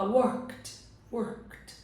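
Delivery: "worked" in one syllable ending in a T. Shown as a demonstration. Teacher modelling for learners, "worked" with silent E and final T. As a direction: run the K of "worked" straight into T. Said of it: "Ele vai ter um som de T".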